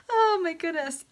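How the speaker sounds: high, breathless after laughing; real amusement. Real, not acted. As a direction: catch your breath from laughing.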